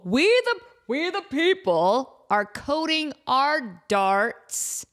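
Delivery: slow grand drawl on we the people; dramatic slow. Real, not acted. Host stretching out we the people, slow and grand. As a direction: stretch each word like a proclamation.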